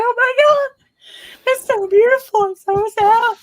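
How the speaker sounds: very high, tearful wail; mock sobbing. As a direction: gush through tears at a cartoon. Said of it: "I'm like".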